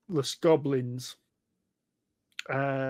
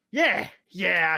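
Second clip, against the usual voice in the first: higher, louder little goblin; eager goblin; 4 semitones higher, 6 dB louder than usual. Acted. Goblin agrees to lead the charge, high and eager.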